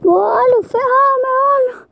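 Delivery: high, loud yelp of sudden pain. Real, not acted. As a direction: cry out as something hits your eye. Something hits his eye while riding.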